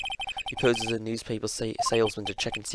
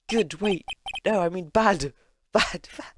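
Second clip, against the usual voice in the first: flustered correction; 9 semitones higher, 4 dB louder, slower than usual. Acted. Acts flustered Phoenix correcting himself.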